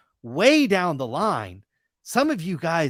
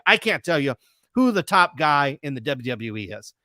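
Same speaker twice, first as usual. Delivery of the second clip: usual speaking voice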